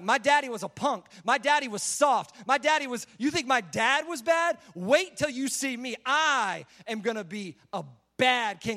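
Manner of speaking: emphatic, boastful taunt with punched words; trash talk. Acted. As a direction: talk trash like a cocky young king, drawing out key words. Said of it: "Bible trash talk".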